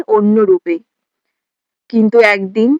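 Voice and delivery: normal talking voice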